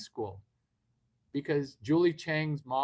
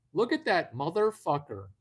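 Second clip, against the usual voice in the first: ordinary talk, nothing marked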